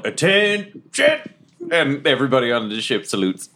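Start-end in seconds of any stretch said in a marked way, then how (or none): 0.0-0.8 high, drawn-out call to attention; mock yell. To everybody on the ship, mock yell. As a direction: call out a higher-pitched order, not full volume. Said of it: "And then I just yell".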